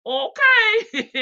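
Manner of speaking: high, laughing okay; giggling through the jab. Real, not acted. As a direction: crack up on the word. Reading mom's jab, cracking up.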